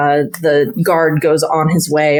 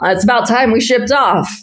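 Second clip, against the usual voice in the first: teasing guard calling out, higher and quick; joking guards; 6 semitones higher, faster than usual. Retold or imitated. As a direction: rib the stable hand like a bored guard. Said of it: "they say".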